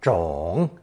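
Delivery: ZHONG in a long dipping third tone. Shown as a demonstration. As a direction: let "zhong" sink low and rise again. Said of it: "We'll begin with third tone".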